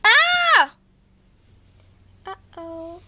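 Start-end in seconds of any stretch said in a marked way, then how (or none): 0.0-0.7 loud high shriek of falling; high scream. Acted. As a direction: shriek as you go down. Pushed over.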